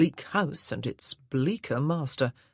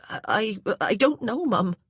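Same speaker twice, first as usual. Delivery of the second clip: flustered stammer; 6 semitones higher, 3 dB louder than usual. A narrator's reading. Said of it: "with her fluster".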